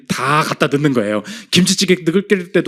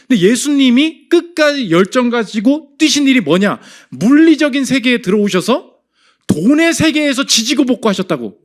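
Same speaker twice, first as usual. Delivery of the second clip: raised, forceful pulpit delivery; impassioned preaching; 7 semitones higher, 4 dB louder than usual. Real, not acted. Pastor telling the congregation how Jesus worked, impassioned preaching. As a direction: drive the point home high and loud.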